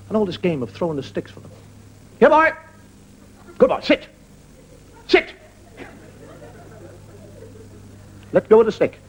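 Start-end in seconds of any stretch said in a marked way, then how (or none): 2.2-9.0 dog owner commands. Retold or imitated. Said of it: "You see him walk with the dogs".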